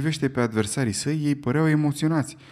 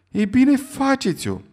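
much higher, louder, slow daring challenge; challenging; 13 semitones higher, 7 dB louder, slower than usual. Acted. Stewart daring Fogg to do it, high and loud. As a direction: throw down the gauntlet.

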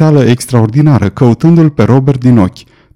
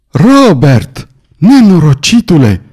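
raised, drawn-out call after someone; anguished cry, understated; 5 semitones higher, slower than usual. A narrator's reading. Lord calling after the boy who has ridden off, moderate cry. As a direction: cry out with moderate force, pitch up. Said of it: "strigă Glenarvan".

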